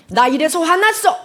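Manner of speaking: loud, high mock-angry complaint; angry outburst. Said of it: "라고 상대가 하는 것보다".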